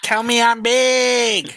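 very high, slow squeak; squeaky high voice. Pretend. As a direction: stretch it out high and silly. Mock dirty talk.

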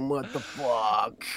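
slow, stretched mock American; go-to American accent, drawn right out. Shown as a demonstration. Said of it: "that's the go-to American accent".

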